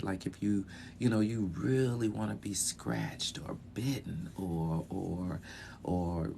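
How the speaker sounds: soft, low, confiding tone. Shown as a demonstration. Teacher showing how delivery conveys desire, hushed and sultry. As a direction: let the voice sink and quieten as it goes. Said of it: "a little deeper in my speech".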